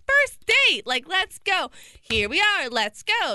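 high, loud and thrilled; bursting with first-date excitement; excited. Retold or imitated.

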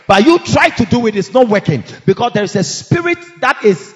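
normal talking voice

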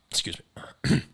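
choking a little; catching the throat, coughing; choking on a drink. Real, not acted. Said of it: "It's, like, choking there a little bit".

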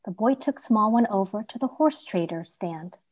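ordinary delivery, nothing marked

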